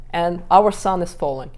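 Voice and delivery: normal talking voice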